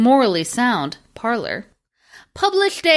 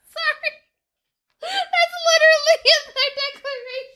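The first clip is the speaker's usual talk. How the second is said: high-pitched laughing through words; laughing hard; 14 semitones higher than usual. Real, not acted. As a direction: crack up while talking. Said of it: "I know you're busy dying".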